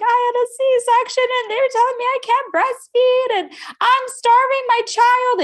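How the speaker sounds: high, loud mock sobbing complaint; retold bawling. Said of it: "I just started bawling".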